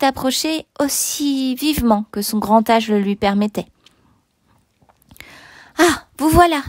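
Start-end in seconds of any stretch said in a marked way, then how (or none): 5.8-6.6 high, glad exclamation; exclaimed. A narrator's reading. Bishop greets the returned prisoner, exclaiming gladly. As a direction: greet him with a higher-pitched cry. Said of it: "s'écria-t-il".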